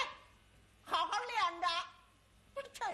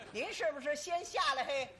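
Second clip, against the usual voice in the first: usual speaking voice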